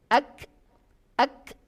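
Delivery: ak with a hiss of breath after it; ak demo. Shown as a demonstration. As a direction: release ak as breath, no bounce. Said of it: "Kira-kira seperti itu".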